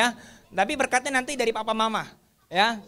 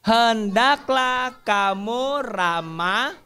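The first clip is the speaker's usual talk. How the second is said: slow recitation; much slower than usual. Acted. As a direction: lead the class word by word. Leads children reciting verse.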